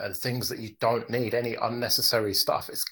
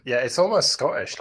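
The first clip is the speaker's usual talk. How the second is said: ordinary delivery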